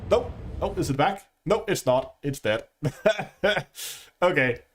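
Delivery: usual speaking voice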